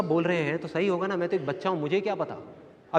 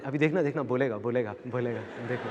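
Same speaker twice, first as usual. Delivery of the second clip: low, hushed aside to bystanders; confiding aside; 6 semitones lower, 8 dB quieter than usual. Retold or imitated. Aunt tells those beside her to watch, hushed. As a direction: drop the voice to tip others off. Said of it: "अपने साथ वालों को बोलती".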